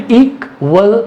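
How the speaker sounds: इक्वल broken slowly into IK, WAL; syllable by syllable. Shown as a demonstration. Teacher showing two sounds, इक्वल split as IK, WAL. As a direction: say इक्वल as two separate beats, IK then WAL. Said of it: "पहला sound किस में आ रहा है".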